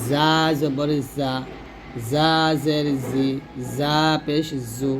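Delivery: slow drill with buzzed "z" in জা; drawn-out, chirring জা; buzzing za. Shown as a demonstration. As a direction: hold the buzz on জা, জি, জু. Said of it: "পাখির মতো ঝি ঝি আওয়াজে".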